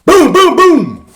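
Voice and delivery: high, loud imitated booms; loud booming knocks. A narrator's reading. Narrator imitating pounding on the door, loud booms. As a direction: boom it out in three hard bursts.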